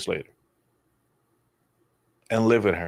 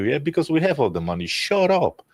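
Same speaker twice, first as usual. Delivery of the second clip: sarcastic retort; 6 semitones higher than usual. Pretend.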